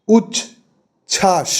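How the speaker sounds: "উচ্ছ্বাস" very slow, split uch and chhash; two closed syllables. Shown as a demonstration. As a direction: leave a gap inside "উচ্ছ্বাস". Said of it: "এই দুটো". ("উচ্ছ্বাস" ধীরে, দুই রুদ্ধ দলে ভেঙে)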